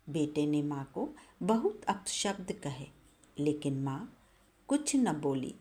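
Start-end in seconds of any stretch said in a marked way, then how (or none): none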